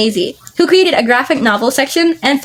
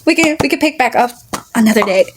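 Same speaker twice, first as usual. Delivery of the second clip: ordinary delivery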